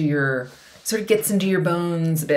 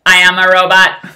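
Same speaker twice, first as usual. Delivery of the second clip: blurted out very loud; loud robot announcement; 2 semitones higher, 14 dB louder than usual. Retold or imitated. Blurting to strangers in a lobby, very loud. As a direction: declare it loudly to the lobby. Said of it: "but I said quite loudly".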